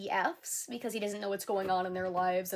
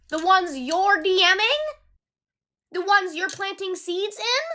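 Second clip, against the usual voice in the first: higher, louder, slow sarcastic questions; mocking incredulity; 8 semitones higher, 6 dB louder, slower than usual. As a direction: drag out each question to mock his line. Mocking his claims back at him.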